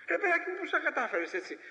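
high, laughing mock wonder; mock amazement. Preacher mocking a man who sides with everyone, laughing amazement. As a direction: marvel sarcastically at his cleverness.

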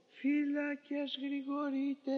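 slow, high, drawn-out call to the guards; drawn-out night watch cry. Retold or imitated. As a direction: call it slowly across the prison wall. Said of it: "ετούτος εφώναζε".

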